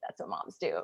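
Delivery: voiceless confiding whisper; whispered aside. Real, not acted. Confiding to viewers about moms, whispered aside. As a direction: drop right down into a whisper.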